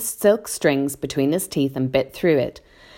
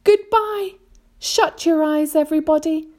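high-pitched, helpless farewell; despairing cry; 12 semitones higher than usual. A narrator's reading. James says farewell as the peach falls, despairing. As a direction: say goodbye high, bracing for the end. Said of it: "cried James".

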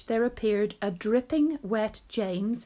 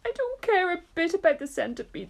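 high, whiny storybook sob; acted weepy wail; mock sobbing; 9 semitones higher, faster than usual. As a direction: put on a tearful, high earthworm voice. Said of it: "the earthworm sobbed".

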